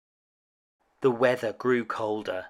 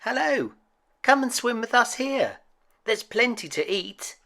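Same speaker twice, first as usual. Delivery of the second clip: much higher, friendly swan voice; 10 semitones higher than usual. A narrator's reading. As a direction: welcome him in like a kindly swan. Swans voiced in story.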